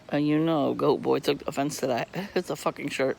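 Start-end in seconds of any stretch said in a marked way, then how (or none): none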